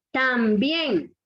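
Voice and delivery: "También" with weight on "bién"; isolated example, final syllable of "También" stressed; stress on last syllable. Shown as a demonstration. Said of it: "fuerza de voz en la última sílaba".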